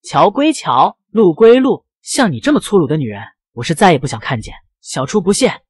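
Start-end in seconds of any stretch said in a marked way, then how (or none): none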